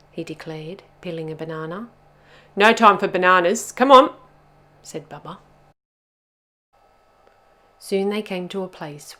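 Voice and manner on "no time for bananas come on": bossy. A narrator's reading. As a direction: hurry them along, no nonsense. Bubba orders the others on.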